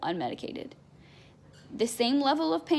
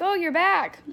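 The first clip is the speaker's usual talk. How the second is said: a higher, louder voice for the hospital staff, surprised she is back; lightly voiced nurses, higher and louder, amused to see her again; mock surprised greeting; 6 semitones higher, 4 dB louder, slower than usual.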